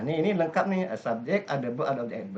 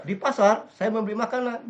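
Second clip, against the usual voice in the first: "Di pasar" raised high and loud; stressed fronted phrase; 8 semitones higher, 5 dB louder than usual. Shown as a demonstration. Teacher demonstrates emphasis on place phrase. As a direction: hit "Di pasar" hard and high.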